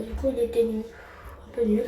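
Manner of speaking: ordinary delivery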